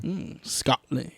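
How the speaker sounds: slow, soft, badly faked Scottish burr on Scotland; a hammed-up Scottish accent, dragging out the word; terrible Scottish accent. Reading the whisky label to his co-host, in a badly faked Scottish accent. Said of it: "Terrible Scottish accent".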